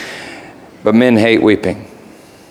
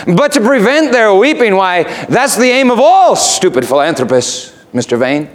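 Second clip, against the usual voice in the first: scolding raven; 10 semitones higher than usual. Acted. Raven scolding Mister Vane.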